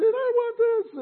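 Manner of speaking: put-on loud, high-pitched child's demand; screaming child. Little girl demanding cereal or candy, imitated high scream. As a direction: scream it like a little girl's tantrum. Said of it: "screaming out and said".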